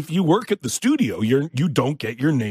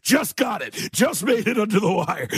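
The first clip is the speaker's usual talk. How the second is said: a higher, lightly relieved studio head; light Ted Sarandos impression; 8 semitones higher than usual. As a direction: toss it back over the shoulder, relieved. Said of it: "and was like".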